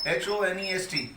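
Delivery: "एच ओ एन ई एस टी" slowly, letter by letter; spelled out. Shown as a demonstration. Teacher reading the written word to students, "एच ओ एन ई एस टी" spelled out. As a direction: spell "एच ओ एन ई एस टी" as separate letter names. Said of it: "यहाँ word लिखा हुआ है".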